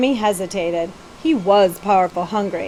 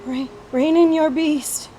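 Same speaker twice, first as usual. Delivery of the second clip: stiff frightened whisper; 6 semitones higher than usual. Acted. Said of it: "he whispered stiffly".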